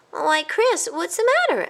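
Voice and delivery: high, worried mouse voice; concerned mouse. A narrator's reading. A cousin worries over his choking cousin, high. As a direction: ask with alarm.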